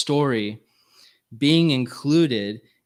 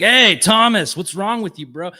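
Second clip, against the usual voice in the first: much higher and louder, a bursting-in yell; brash Kool-Aid man; 7 semitones higher, 8 dB louder than usual. Retold or imitated. Jesus imagined bursting through the wall at Thomas, brash yell. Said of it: "like the Kool-Aid man".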